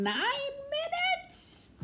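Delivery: very high, drawn-out squeal of disbelief; mock-horrified high exclamation; shocked squeal. Real, not acted. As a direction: stretch it out in a shrill shock. Shocked at how long she talked.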